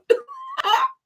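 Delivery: squealing high laugh; high burst of laughter. Real, not acted. Laughs at mic mishap.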